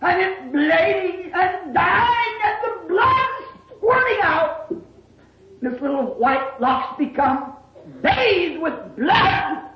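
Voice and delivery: drawn-out, climbing preacher's cry; impassioned preaching. Real, not acted. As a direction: preach it slowly, pitch rising high. Preaches at rising force.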